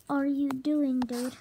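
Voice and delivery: loud alarmed yell; shout. Pretend. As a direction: yell it in alarm at your friend. Friend shocked at the plan.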